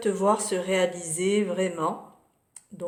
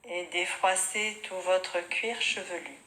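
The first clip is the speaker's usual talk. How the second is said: softer, unhurried yoga nidra guidance with long pauses; guided meditation; 4 dB quieter than usual. Real, not acted. Yoga teacher guiding students lying on their mats, calm meditation voice.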